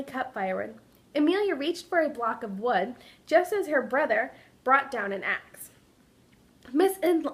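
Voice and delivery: expressive read-aloud storytelling voice; story narration. A narrator's reading. Teacher reading a picture book to kindergartners, story narration. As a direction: tell it like a picture book to little kids.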